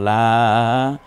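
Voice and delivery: লা with the vowel held very long; three-alif stretch. Shown as a demonstration. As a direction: draw out লা on one sustained tone. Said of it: "আমরা তিন আলিফ টেনে বলবো".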